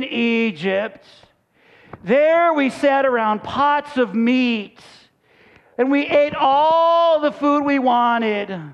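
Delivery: slow, drawn-out mock whining; whiny voice. Hungry Israelites grumbling about the food in Egypt, hammed-up whine. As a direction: whine it out, stretching the words. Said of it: "read this kind of in a whiny voice".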